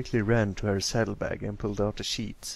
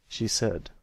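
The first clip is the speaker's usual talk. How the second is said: ordinary delivery, nothing marked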